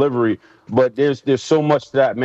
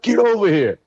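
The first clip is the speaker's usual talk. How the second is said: mock coach yell; 8 semitones higher than usual. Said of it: "He's like".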